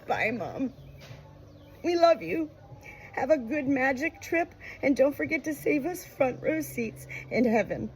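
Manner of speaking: put-on high child's call upward; a little girl waving goodbye. A narrator's reading. Daughter calling to her dying mother, high childlike call. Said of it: "waving called out".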